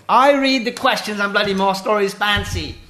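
loud, high put-on English accent. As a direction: proclaim it in a mock-posh English voice. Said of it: "answer in an English accent".

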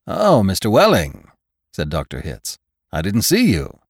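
bright, loud, bustling doctor; cheerful hurried official; brisk doctor voice. A narrator's reading. Hitz voiced by reader.

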